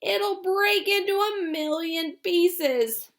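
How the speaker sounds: put-on a frightened old man protesting; high slow old grandpa, worried; alarmed Grandpa Joe. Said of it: "shouted Grandpa Joe".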